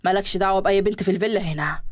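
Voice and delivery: men's voices in a read story; low male guests. Acted. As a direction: play the businessmen, voice dropped low. Men at the party, voiced low.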